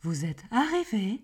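gps voice. Shown as a demonstration. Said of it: "elle a pas une voix de chagasse comme ça".

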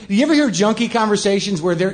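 normal talking voice

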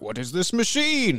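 put-on slow, high, bewildered old-timey voice; puzzled man out of time; confused Lincoln. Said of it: "I'd be like".

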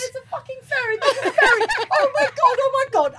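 high, loud, frantic laughing panic; panicked repeating. Retold or imitated. Stranded at sea, spotting the ferry, frantic panicked shouting. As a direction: blurt it over and over in panic.

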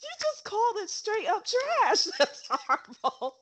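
high-pitched, real laughter breaking into the words; cracking up. Real, not acted. Co-host teasing friend about the text, cracking up. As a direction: talk through tears of laughter. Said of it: "you are cracking up".

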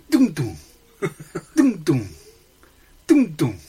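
loud sung beeps; ticket machine beeps. Retold or imitated. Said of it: "they go".

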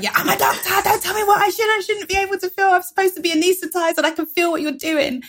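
high-pitched, rushed indignant outburst; indignant protest. Retold or imitated. Patient to surgical team who dismiss her pain, indignant.

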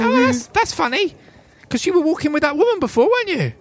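high, knowing urchin voice; sly street urchin. Acted. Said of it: "They say".

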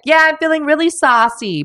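high-pitched piss-taking reply; mocking wife. Wife taking the piss out of her husband, high mock agreement. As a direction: imitate a wife playing along mockingly. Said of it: "taking the piss out of him".

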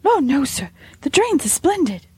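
put-on high, meek servant girl; a nervous young housemaid answering; timid maid voice. Maid voice, eager.

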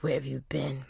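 slow, low, whispered reproach; mock-wounded, drawn-out whisper; cat with attitude. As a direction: imitate a sulky cat in a hushed voice. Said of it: "a little bit of attitude".

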